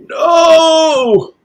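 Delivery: high, loud emphatic NO. Shown as a demonstration. Teacher showing emphatic no. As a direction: say no as a strong emphatic denial. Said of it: "then it's an emphatic".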